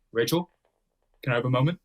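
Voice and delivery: lightly imitating a sincere suitor; a hint of his voice, pausing after the name; Zach impression. Guest imitating him asking Rachel aside, light impression. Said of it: "give your best impersonation of Zach's voice".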